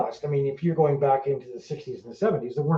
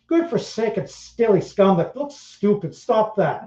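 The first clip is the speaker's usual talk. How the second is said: higher, slow put-on Irish scolding; higher, slow mock Irish telling off; mock Irish accent; 6 semitones higher, 3 dB louder, slower than usual. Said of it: "Irish people be like".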